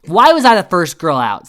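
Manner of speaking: loud, high imitation of outrage; hammed-up angry protest; mock pissed-off. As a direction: blurt it as an indignant complaint. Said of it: "Eliza's pissed".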